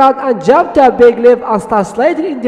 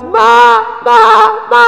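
goat bleating; 9 semitones higher than usual. Acted. Rescued goat hugging Diego, high imitated bleats. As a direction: bleat three times like a goat. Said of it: "Goat hug Diego and goes".

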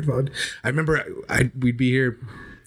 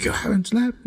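much higher, incredulous mock outrage; retold angry disbelief, not shouted; mad grandpa; 12 semitones higher, slower than usual. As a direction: imitate grandpa's indignant question, pitch leaping up. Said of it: "he'd get all mad".

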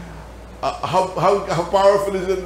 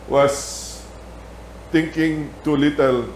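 normal talking voice

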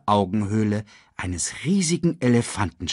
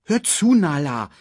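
high, louder cub scolding; slightly annoyed; 8 semitones higher, 5 dB louder than usual. A narrator's reading. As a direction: demand attention with a touch of irritation. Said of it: "sagte Simba etwas verärgert".

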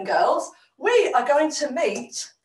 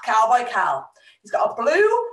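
ordinary delivery, nothing marked

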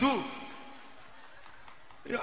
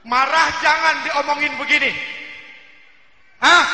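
much louder, shouting; mock angry shout; 13 dB louder than usual. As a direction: blurt it out loud in comic anger. Ustadz menahan marah, berteriak. (teriakan marah pura-pura)

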